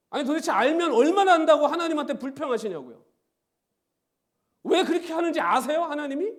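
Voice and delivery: loud, high, fervent preaching with a laugh; preaching at full force. Acted. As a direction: drive it home to the congregation. (열정적으로 외치는 설교)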